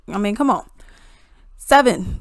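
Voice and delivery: ordinary talk, nothing marked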